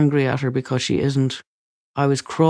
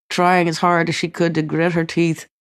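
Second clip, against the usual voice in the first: ordinary delivery, nothing marked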